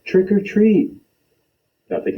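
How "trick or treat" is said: higher and louder call; a little louder. Acted. Boy calling out trick or treat a little louder. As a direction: raise the voice a notch. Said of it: "a little louder".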